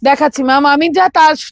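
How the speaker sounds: ordinary delivery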